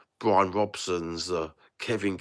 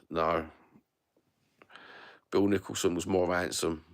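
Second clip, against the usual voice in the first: ordinary talk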